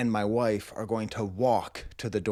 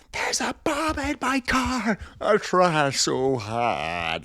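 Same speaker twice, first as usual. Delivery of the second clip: mock lyric; 7 semitones higher, much slower than usual. Mixing song lyric and cartoon voice.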